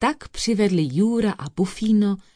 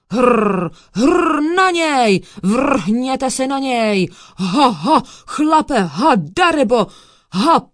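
high, loud barking war cry with rolled r; loud shouting; 5 semitones higher, 7 dB louder, slower than usual. A narrator's reading. Dog sets upon the thief, roaring and barking. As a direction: bark out a furious volley of attack orders. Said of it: "vykřikl Bufino silným hlasem".

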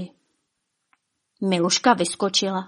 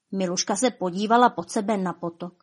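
usual speaking voice

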